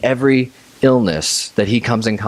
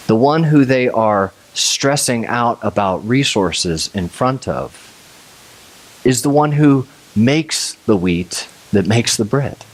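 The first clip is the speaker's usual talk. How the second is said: ordinary talk, nothing marked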